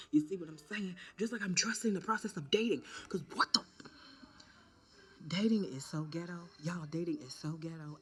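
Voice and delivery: excited dating rant. Real, not acted.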